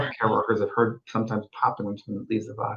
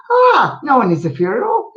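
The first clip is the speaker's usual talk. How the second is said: Scottish woman; 11 semitones higher, 5 dB louder than usual. Retold or imitated. Medium retelling a Scottish spirit, high Scottish lady voice. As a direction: play the old Scottish woman, bemused. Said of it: "it was a Scottish woman".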